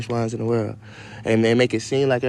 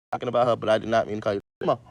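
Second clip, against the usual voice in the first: ordinary talk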